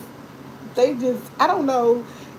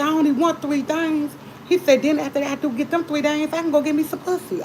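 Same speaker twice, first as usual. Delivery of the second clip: higher, cocky imitation of her brother; brother's voice; 6 semitones higher than usual. Sister mimicking her brother telling the doctor his wants, higher cocky voice. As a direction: pitch it up like the bragging brother.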